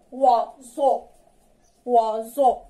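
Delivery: "وازو" said clearly with a z sound in the middle; pronunciation demo. Shown as a demonstration. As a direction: stress the z in "وازو". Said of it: "كيتنطق از". (نطق "وازو" بصوت ز)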